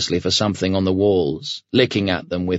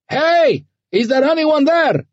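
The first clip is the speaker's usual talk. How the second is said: very high, louder calling yell; 5 dB louder than usual. A narrator's reading. Ahmed calling into the empty station for people, yelling high. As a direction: call out at the top of your voice. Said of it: "Ahmed yelled as loud as he could".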